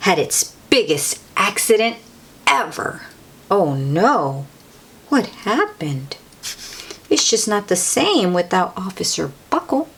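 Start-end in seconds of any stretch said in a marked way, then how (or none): none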